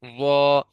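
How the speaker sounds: ضا said full and heavy, tongue thick; a thick, full-mouthed ضا; heavy zuad. Shown as a demonstration. Teacher demos the heavy ضا to students. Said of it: "ضا کو پر کر کے".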